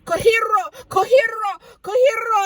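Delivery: high, loud cry of a strange word; retold screamed cry. Storyteller reliving the panicked run through the house, crying out. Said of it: "I'm crying and I'm screaming".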